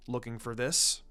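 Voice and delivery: lightly voiced calm sensei, teasing; cool voice. A narrator's reading. Sensei quietly teases the boy holding his kunai. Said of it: "a cool voice behind him called out".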